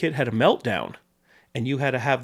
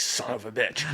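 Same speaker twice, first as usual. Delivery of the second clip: muttered curse; 3 semitones lower, 7 dB quieter than usual. Retold or imitated. Producer's reaction, retold.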